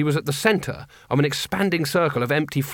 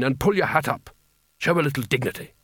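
usual speaking voice

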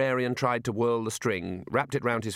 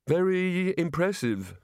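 slow, higher sighed remark; weary sigh; 7 semitones higher, slower than usual. A narrator's reading. Rincewind at the foot of a rickety ladder, sighing. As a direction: say it on a long sigh. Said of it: "sighed Rincewind".

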